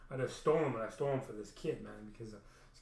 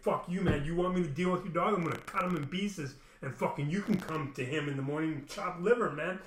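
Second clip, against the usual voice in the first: loud, raised-pitch angry threat; raging; 4 semitones higher, 6 dB louder than usual. Retold or imitated. Young man threatening the neighbor over his dog, raging. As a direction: have it out with him, loud and heated. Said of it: "I was raging".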